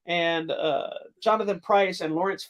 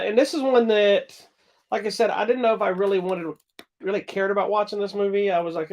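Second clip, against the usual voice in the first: ordinary talk, nothing marked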